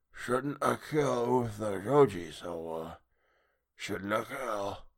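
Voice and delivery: slow, hushed monster voice; eerie soft creature speech; sea creature. A narrator's reading. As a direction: speak slowly as an inhuman sea beast.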